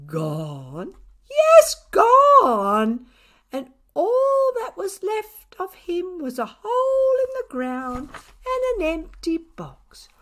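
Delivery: milk the mystery for all it's worth; rising theatrical astonishment; dramatic neighbour. A narrator's reading.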